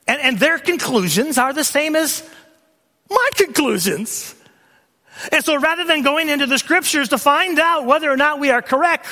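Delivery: high, forceful sermon delivery; preacher at full force; impassioned preaching. Real, not acted.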